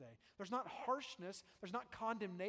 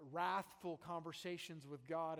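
ordinary delivery

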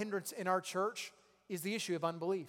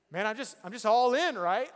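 excited; 4 semitones higher, 8 dB louder than usual. Real, not acted. Recounting fan excitement.

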